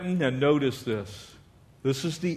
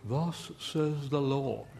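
slow, softer, pompous Oxford voice; mock Oxford accent; 2 semitones lower, 8 dB quieter, slower than usual. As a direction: parody a legalist intoning in Oxford English. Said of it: "so it's usually in Oxford".

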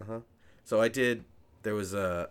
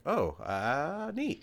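high, halting, awkward mock reply; unsure how to react; 9 semitones higher, much slower than usual. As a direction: fumble a polite response to praise. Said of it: "I don't know how to handle that".

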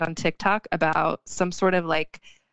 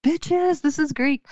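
high, indignant sass; sassy retort; 9 semitones higher, slower than usual. Retold or imitated. To TikTokers claiming Greek coffee, high sassy scolding. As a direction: put the TikTokers in their place, way up high. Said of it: "And I'm like".

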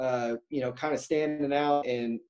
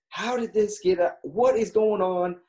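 mock annoyed demand, pitched up; retold angry outburst; 5 semitones higher than usual. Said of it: "And he gets pissed".

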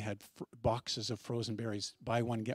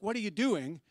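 higher-pitched imitation of an annoyed driver; retold outburst; 7 semitones higher than usual. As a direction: mimic her bursting out, without real volume. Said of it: "kind of bursts up at me".

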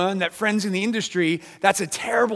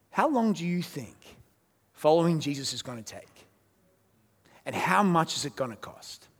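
softer, with long pauses; solemn questions; 5 dB quieter than usual. Real, not acted. Pastor asking the church about following Jesus, solemn and soft. Applying to discipleship.

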